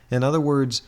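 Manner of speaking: ordinary talk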